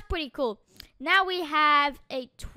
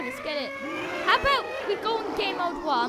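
ordinary delivery

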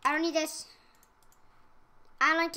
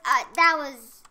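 usual speaking voice

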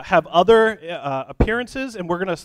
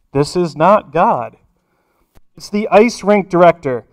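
booming voice from above; 2 semitones higher, 13 dB louder than usual. Acted. Rink director scolding the fisherman, booming up to a yell. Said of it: "The voice from the heavens comes out again".

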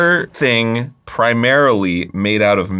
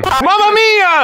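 slow, high, hammed-up Italian accent; stretched-out Italian accent, much higher; Italian exclamation; 4 dB louder, slower than usual. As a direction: throw out a cartoon Italian cry. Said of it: "you gave her an Italian voice".

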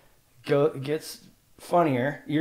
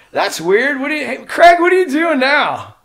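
high, loud startled question; mock surprise; 13 semitones higher, 9 dB louder than usual. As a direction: play it as a startled teen. Retold surprise at Craig.

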